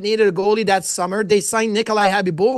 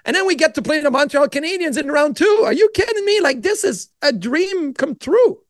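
a touch of mock disbelief, rising higher; retold excitement; 6 semitones higher than usual. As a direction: replay the thrill of a kid's dream coming true. Retold excited thought before series.

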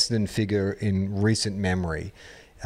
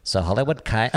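badly faked Liverpudlian accent; attempted Liverpool accent. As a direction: try on a mock Liverpool accent. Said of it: "How do you do Liverpool?".

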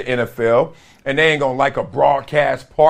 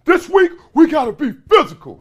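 higher, louder coach demand; stern coach; 11 semitones higher, 4 dB louder than usual. Retold or imitated. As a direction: bark it like a coach before a big game. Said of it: "Every time my coach said".

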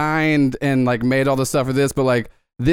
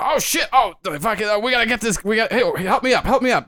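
panicked guard; 7 semitones higher than usual. Acted. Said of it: "you hear one go".

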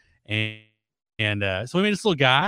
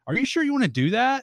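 doubtful boss; 11 semitones higher than usual. Retold or imitated. Boss voiced in story.

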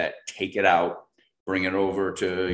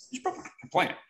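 accusing look; 12 semitones higher, 4 dB quieter than usual. Retold or imitated. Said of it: "I definitely got that hairy side eye".

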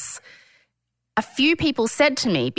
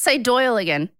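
usual speaking voice

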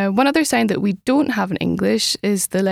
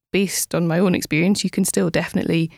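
ordinary delivery

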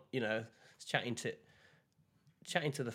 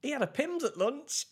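much higher, louder incredulous voice; mock outrage; 6 dB louder than usual. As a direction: blurt it out in high, loud disbelief. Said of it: "And he went".

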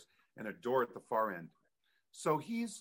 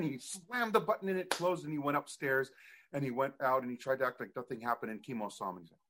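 usual speaking voice

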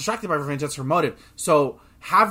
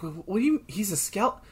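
confused disbelief; 8 semitones higher than usual. Retold or imitated. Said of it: "and, like".

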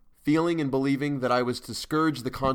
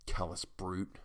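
muttered to himself; 2 semitones lower, 7 dB quieter, slower than usual. A narrator's reading. Narrator mutters to himself about a colleague, muttered softly. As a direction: mutter it under your breath. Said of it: "I muttered to myself".

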